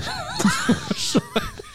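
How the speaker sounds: squealing laugh; high burst of laughter. Real, not acted. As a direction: laugh breaking out. Breaks down laughing.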